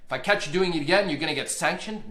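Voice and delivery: loud, quick judge's warning; retold stern warning. Judge warning the attorney about sanctions, loud. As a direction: warn the counsel, loud and fast. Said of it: "He says".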